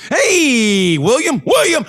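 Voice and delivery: high, drawn-out holler of a name; mock calling out. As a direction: call someone out across an office, hammed-up. Acting office shooter calling out.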